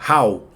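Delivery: "How" said like Spanish "jau"; Spanish-style spelling sound. Shown as a demonstration. Teacher spells out the sound, like "jau". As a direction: open the vowel of "How" into "ah-oo". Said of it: "casi como si estuvieran diciendo J A U".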